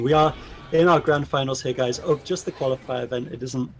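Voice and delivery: ordinary delivery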